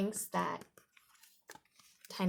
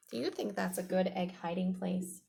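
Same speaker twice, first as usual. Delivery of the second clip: plain reading voice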